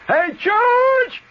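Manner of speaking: loud high call across the back yard; hollering. Retold or imitated. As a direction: yell his name out from the back. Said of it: "and he hollers".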